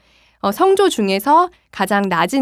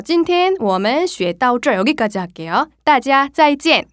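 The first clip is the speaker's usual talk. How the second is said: slow teacher Mandarin; 4 semitones higher, much slower than usual. Acted. Lesson closing in Mandarin.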